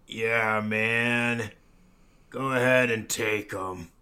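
lightly voiced mellow hippie; a hint of a slow stoner drawl; stoned hippie voice. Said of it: "in the hippie voice".

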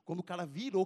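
normal talking voice

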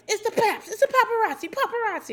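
high, loud, hammed-up panic; mock panicked shout. Family spotting a drone, mock alarm shout. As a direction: squeal it like a spooked reality star.

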